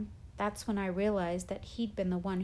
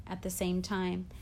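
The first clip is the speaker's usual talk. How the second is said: ordinary delivery, nothing marked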